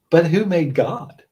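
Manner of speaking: put-on high, slow child's question; imitating small son. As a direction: ask it like a curious four-year-old, loud and drawn out. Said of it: "he smiled. He goes".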